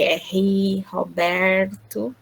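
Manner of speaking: São Paulo accent, pulling the R in "Roberto"; slow, stretched "Roberto" with a dragged São Paulo R; sotaque de São Paulo. Shown as a demonstration. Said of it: "eles puxam o R". ("Roberto" arrastado, com o R puxado de São Paulo)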